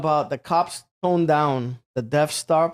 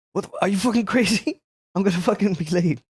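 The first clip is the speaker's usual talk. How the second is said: high, hurried indignant protest; higher-pitched retold griping without shouting; mock exasperated complaint; 3 semitones higher, faster than usual. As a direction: imitate a worked-up friend fretting. Acting out friend panicking about lateness.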